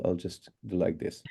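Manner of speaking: usual speaking voice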